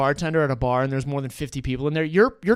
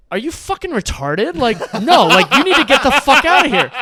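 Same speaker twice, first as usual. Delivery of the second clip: loud, high indignant outburst; retold angry telling-off; 10 semitones higher, 11 dB louder than usual. Bartender throwing the kid out of the bar, angry. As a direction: build into a loud, high rebuke.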